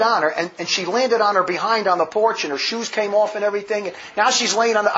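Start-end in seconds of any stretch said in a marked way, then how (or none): none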